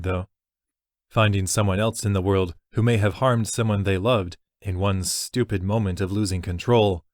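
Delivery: ordinary delivery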